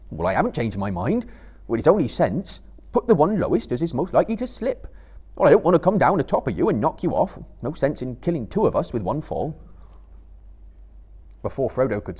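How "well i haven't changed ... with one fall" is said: louder, quicker stubborn hobbit; Sam. A narrator's reading. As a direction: Sam insisting he'll go lowest. Sam argues his reasoning.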